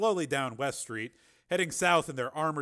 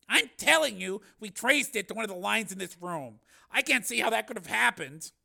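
higher, louder defensive protest; baffled insistence; 9 semitones higher, 7 dB louder than usual. A narrator's reading. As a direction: insist helplessly that you did it right.

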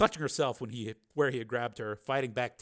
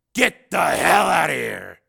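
loud, slow, higher roar; furious shout; 4 semitones higher, 12 dB louder, slower than usual. Acted. Steele driving Higgins from the room, furious shout. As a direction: bellow each word slowly.

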